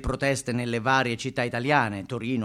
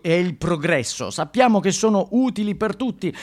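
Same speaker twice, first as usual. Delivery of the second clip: protest sign read aloud; 6 semitones higher, 7 dB louder than usual. Acted. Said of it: "leggi questo".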